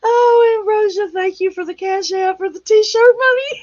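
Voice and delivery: high, loud, breathless laughter through the words; gasping thanks while cracking up; laughing. Real, not acted. Thanking Ambrosia for T-shirt money, laughing breathlessly. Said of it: "you have me laughing so hard I can't breathe".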